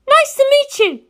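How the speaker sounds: put-on a high, fierce girl set on revenge; Penelope's voice. Penelope greets politely.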